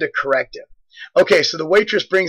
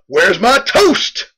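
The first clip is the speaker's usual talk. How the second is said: blurted demand; 4 semitones higher, much slower than usual. Retold or imitated. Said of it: "he just blurred out".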